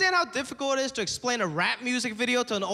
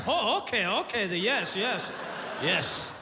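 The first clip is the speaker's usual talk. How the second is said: dad pretending to understand; slower than usual. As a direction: agree politely without getting it. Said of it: "he's like".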